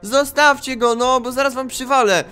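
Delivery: loud, high, drawn-out outcry; anguished protest. Acted. Boy whose dog is being taken, loud anguished protest. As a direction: wail in protest as the pet is snatched. Parents take his dog away.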